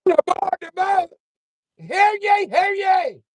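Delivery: very high, loud mock herald shouting; hammed-up crier at the bow of the boat; town crier shout. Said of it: "Be Wasp on the front, like".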